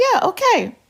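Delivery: high, unbothered okay; mock okay. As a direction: brush him off lightly. Mock agreement to husband.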